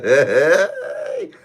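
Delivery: loud high cackle bursting out; helpless high laughter; real laughing fit. Real, not acted. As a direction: crack up mid-story. Cracks up while reading story.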